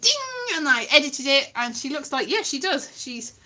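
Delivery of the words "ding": ding sound. Retold or imitated. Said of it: "And then I went".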